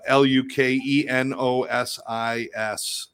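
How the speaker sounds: L U K E N O S I S, each letter slow and separate; dictating a web address to viewers, slow and deliberate; spelled out slowly. Shown as a demonstration. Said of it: "check out lukenosis dot com".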